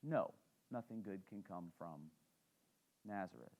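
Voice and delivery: dropped low and soft, writing the town off; dismissive subtext. Retold or imitated. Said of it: "The subtext is".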